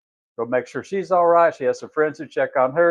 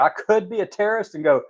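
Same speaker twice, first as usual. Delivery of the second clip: usual speaking voice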